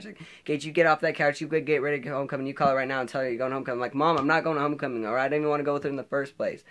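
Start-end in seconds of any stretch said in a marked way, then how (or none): 0.4-3.8 imitating angry mom, fast and louder; rapid-fire orders, higher voice; mad mom. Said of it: "she got all mad". 3.9-6.6 retold fast, raised-voice protest; mad back-talk. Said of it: "being mad and back-talking her".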